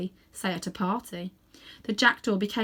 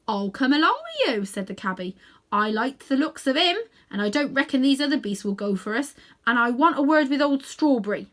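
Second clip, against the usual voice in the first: hearty cabby; 5 semitones higher, 5 dB louder than usual. A narrator's reading. Cabby volunteers.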